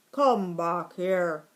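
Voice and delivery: loud, slow bark of a dog; barked order. A narrator's reading. Watchdog calling back the escaping mouse, barked. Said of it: "barked Sam".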